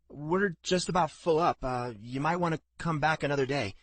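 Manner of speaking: a touch of fear, trying to turn him away; nervous stableman. A narrator's reading. Stableman warns Jensen off, mildly nervous. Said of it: "his voice trembled slightly".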